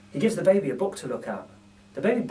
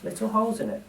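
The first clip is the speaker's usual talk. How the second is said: ordinary delivery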